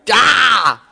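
frustrated ah. Retold or imitated. Frustrated outcry.

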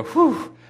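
sudden high "whew"; imitated rebooting whew. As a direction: mimic coming back to life with a high whoop. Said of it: "and they go".

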